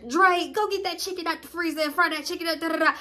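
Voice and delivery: a hint of a mom giving orders; lightly voiced mama.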